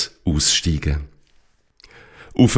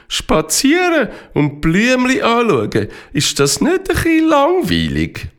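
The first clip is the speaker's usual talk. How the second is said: much higher, skeptical character voice; critical, unimpressed; 14 semitones higher than usual. Acted. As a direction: turn your nose up at flower-watching. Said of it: "sagt der Esteban kritisch".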